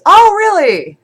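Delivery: high, loud mock-surprised exclamation; mock surprise. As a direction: leap up high as if acting astonished. Acts out the book's author reacting.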